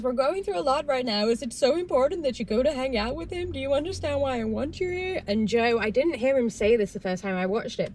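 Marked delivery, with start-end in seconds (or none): 0.0-5.2 lightly voiced upset girlfriend, pitched up; pleading quote. Recapper voices Kaylin begging Joe to stay, pleading. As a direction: imitate a pleading girlfriend with lilting higher pitch.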